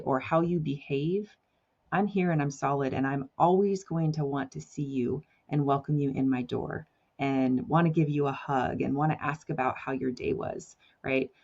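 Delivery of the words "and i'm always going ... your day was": ordinary talk, nothing marked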